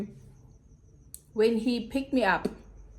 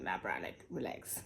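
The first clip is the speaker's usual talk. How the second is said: hushed calming; 12 semitones lower, 15 dB quieter than usual. Retold or imitated. Calming down her angry mother.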